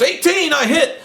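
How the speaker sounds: high mock cry of triumph; imitated gamer's high-pitched cheer; excited announcing. Acting Mike's winning dice roll.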